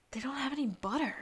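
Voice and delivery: hushed, quick whisper; grave whisper. Retold or imitated. Man breaking fake bad news to Abigail, grave whisper.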